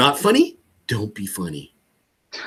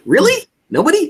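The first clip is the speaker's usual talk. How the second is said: high, louder, incredulous rise; mock disbelief; 4 dB louder than usual. Coach playing caller who hears nobody, disbelieving. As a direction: act shocked that nobody comes to mind.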